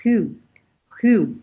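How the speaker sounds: rue with rounded French u, drilled twice. Shown as a demonstration. Teacher drills rue for learners. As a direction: repeat rue slowly for the class. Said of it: "the pronunciation of this word is".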